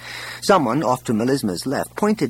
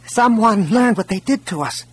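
high-pitched voice from the crowd; hopeful exclamation; 9 semitones higher than usual. A narrator's reading. Refugee in the crowd offers a guess, with eager hope. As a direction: call it out hopefully. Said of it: "Hopeful exclamations gushed from all sides".

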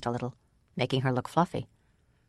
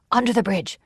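raised, higher-pitched urgent order; urgent command; 6 semitones higher, 4 dB louder than usual. A narrator's reading. Oric orders companions to hide from men above, urgently. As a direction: call it out quickly to get them hidden.

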